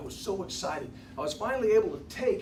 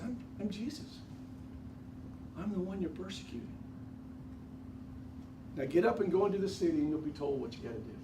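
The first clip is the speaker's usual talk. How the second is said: softer and kinder, lightly voiced; gentle, kind voice; 5 dB quieter, faster than usual. Jesus gently instructing Saul in a kind voice. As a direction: speak gently and kindly to him. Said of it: "his voice got even more gentle, even kinder".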